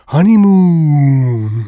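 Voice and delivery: long, loud, stretched "honeymoon"; drawn-out swoon. Acted. Host mocks a film's honeymoon line, drawn-out swoon. Mock-dreamy drawn-out film word.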